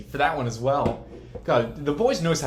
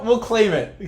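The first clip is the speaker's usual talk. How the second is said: laughing; 9 semitones higher, 11 dB louder, slower than usual. Real, not acted. Hyped, laughing claim.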